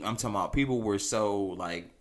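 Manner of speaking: usual speaking voice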